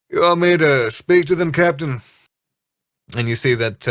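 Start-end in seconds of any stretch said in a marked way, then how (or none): 0.1-2.0 first mate voice. Acted. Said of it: "And he goes".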